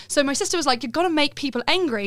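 put-on English accent. Shown as a demonstration. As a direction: retell it in a British accent. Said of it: "I'll do it in this".

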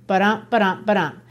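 "ba-dum" chanted da-DUM, second beat stressed; iambic rhythm. Shown as a demonstration. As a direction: tap out "ba-dum" like a heartbeat. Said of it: "So just like the sonnet".

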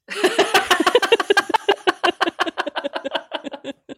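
long, high cackle of laughter; drawn-out peal of laughter; laughing. Real, not acted. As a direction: crack up helplessly. Cracks up at own remark.

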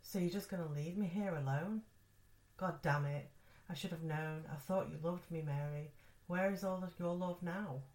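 loud, furious shouting; angry. Acted. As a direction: yell at her in bitter rage. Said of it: "He got angry".

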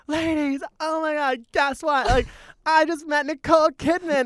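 put-on very high, giddy kid voice; gleeful announcement. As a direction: reenact a thrilled child bragging to strangers. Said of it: "I was so happy".

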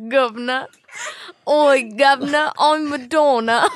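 mock Cockney accent. Cohost piles on the bit in a mock Cockney accent. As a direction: play it broad mock Cockney. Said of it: "my fake British accent".

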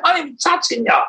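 high, odd-voiced protest; high mock protest; retold protest. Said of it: "I said".

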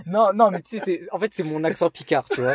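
amused, pleading your case; laughing excuse. Real, not acted. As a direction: laugh while defending yourself. Caught out, laughing at himself.

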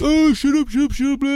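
high, loud imitated screech of shut up; a hammed-up high shriek of a furious colleague; mock furious yell. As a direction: mimic her yelling shut up at you. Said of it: "She's like".

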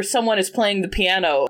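ordinary talk, nothing marked

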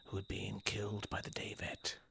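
dramatic whisper. Acted. Grave secret in mock drama.

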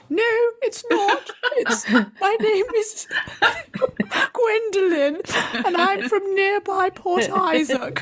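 high, slow, laughing put-on lady; fake posh alias voice. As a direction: pretend to be someone grander, giggling. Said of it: "kept on putting on different voices".

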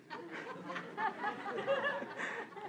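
long high burst of laughter; amused laugh at a funny question. Real, not acted.

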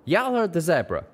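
Calamity yells back; shouting. A narrator's reading. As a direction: throw her words back at her. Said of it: "Calamity shouted".

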